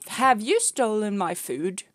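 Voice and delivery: slow, loud, accusing question to the cat; playful loud scolding of a pet; loud mock accusation. Real, not acted. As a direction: drag it out like catching a thief. Scolding the cat over bread.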